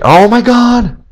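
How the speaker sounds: sudden high startled cry; a real jolt of panic at the computer; high loud alarmed outburst; panicked gasp. Real, not acted. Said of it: "Sorry, I almost panicked".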